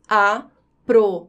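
"Aprovado" in four slow beats, a, pro, va, do; syllable by syllable. Shown as a demonstration. Teacher separating the syllables for students, syllable by syllable. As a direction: chop "Aprovado" into separate syllables with long pauses. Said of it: "Vou separar as sílabas".

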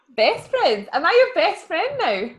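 light up with playful delight, drawing out the first words; happy surprise. Pretend. Co-host reacting to being called best friend, playfully delighted. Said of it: "making a really happy face".

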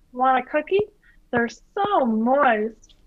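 high, breathy-free slow purr of a sultry cookie lady; Jennifer Coolidge impression.

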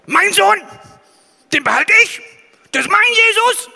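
booming, high and loud tyrant; dark lord. Pretend. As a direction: roar it like a greedy overlord. Imagined greedy God keeping his son.

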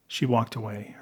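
ordinary delivery

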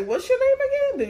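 high-pitched mock query; imitated caller. Callers asking the agent to repeat an unusual name, high imitation. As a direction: jump up high for the caller's question.

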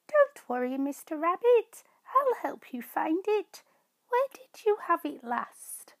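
a little mouse cheering up a friend; comforting mouse. Acted.

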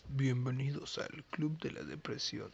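low, slow, soft show intro; gloomy announcer. Acted. Host welcoming listeners to the Club de la Depresión, gloomy announcer. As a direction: announce it slowly in a sunken, hushed voice. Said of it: "tú dilo mejor, tú tienes la voz gruesa".

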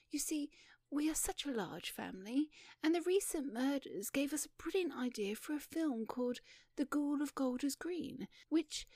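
light, high ingenue voice; shy young woman. Acted. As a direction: explain earnestly and sweetly, a little bashful. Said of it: "and she was shy and beautiful".

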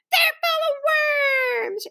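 high, drawn-out mock wail; sobbing. A narrator's reading. Frightened little guest refuses cookies, theatrically sobbing. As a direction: wail it high, stretching the last word. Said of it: "she sobbed".

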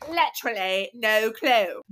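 playful put-on British sign-off; hammed-up British; mock British accent. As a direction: echo it in a fake British accent.

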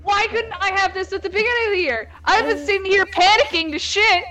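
high, fast mock outrage breaking into laughter; half-laughing retold fury; angry rant. Girl retelling her fury at school, high mock rage. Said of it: "I was fucking angry".

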